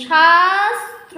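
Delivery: very slow, drawn-out syllable. Shown as a demonstration. Teacher to students, drawing the word out slowly. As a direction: stretch শাস্ত্র out slowly for the class. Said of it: "উম, উচ্চারণ করছি".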